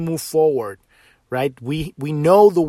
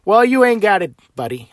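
sharp, louder, higher comeback; loud retort; 4 semitones higher, 6 dB louder than usual. Real, not acted. To dogmatic believers, a loud higher scolding jab. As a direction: call them out with a jab.

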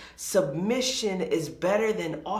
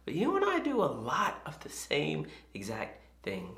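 whispered aside; 7 dB quieter than usual. Real, not acted. Preacher confiding to his listeners, whispered. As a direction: drop to a hushed whisper for the confession.

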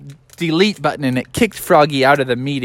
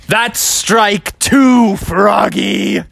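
slow, higher-pitched scolding; teacher's warning; 6 semitones higher, 4 dB louder, much slower than usual. Acted. Teacher to Froggy after his misbehaving, slow scolding. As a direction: draw out a stern warning.

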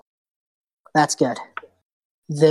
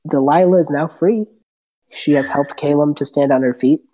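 normal talking voice

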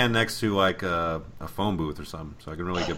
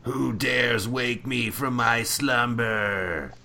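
slow, booming ancient-guardian voice; grinding stone statue; much slower than usual. Acted. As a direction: rumble it out like a waking statue. Mock awakened monster movie voice.

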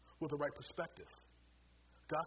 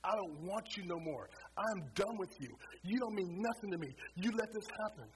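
retold rage, loud and higher; angry outburst at God; 6 semitones higher, 4 dB louder than usual. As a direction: hurl it at God, furious. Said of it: "I just let it all out".

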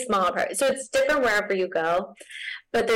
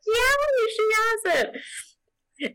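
much higher, drawn-out, slow approval; impressed reaction; 14 semitones higher, much slower than usual. Retold or imitated. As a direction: gush over her talent in a lifted voice. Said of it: "I was like".

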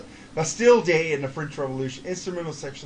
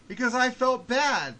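usual speaking voice

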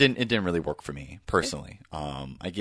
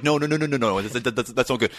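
higher, louder fussing waiter voice; fussy waiter; 5 semitones higher, 3 dB louder than usual. Retold or imitated. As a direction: scold like a doting waiter. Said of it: "And it's like".

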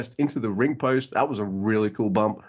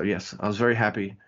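normal talking voice

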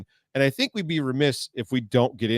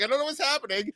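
mock bewildered; 14 semitones higher, 4 dB quieter than usual. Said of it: "I'm like".

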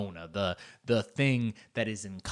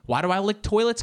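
usual speaking voice

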